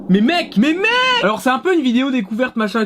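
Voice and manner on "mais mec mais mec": mock alarm rocketing up in pitch; panique surjouée. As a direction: squeal it at your mate in fake horror. Mock panic at the gnome. (affolement exagéré, de plus en plus aigu)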